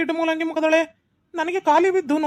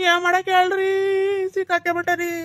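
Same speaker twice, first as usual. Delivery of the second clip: mock crying; 2 semitones higher than usual. Trapped husband pleads with headman, mock crying. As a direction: wail it helplessly through put-on sobs. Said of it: "ಎದಕ್ಲೆ ಅಳಕತ್ತೀಯ".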